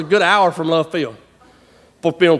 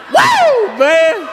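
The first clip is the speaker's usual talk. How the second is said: high, drawn-out cowboy holler; triumphant whoop; 11 semitones higher, 3 dB louder than usual. Retold or imitated. Roper celebrates tying down the cow, triumphant whoop. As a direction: whoop it up like a rodeo win. Acting out his triumph after roping.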